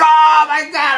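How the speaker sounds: very high agonised shout; retold screaming. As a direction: scream it out in acted agony. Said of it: "And I'm screaming".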